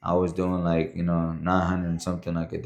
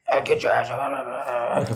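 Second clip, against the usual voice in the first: imitated raspy instructor, pitched up; 4 semitones higher than usual. Instructor Contreras ordering a recruit around, raspy. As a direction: mimic a voice rasping from constant screaming. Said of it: "had, like, a real raspy voice".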